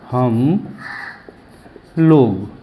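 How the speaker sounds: हम, a long pause, then लोग on its own; word by word. Shown as a demonstration. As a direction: dictate हम and लोग as separate words. लिखते हुए अलग-अलग बोलना. (हम और लोग अलग-अलग, रुककर)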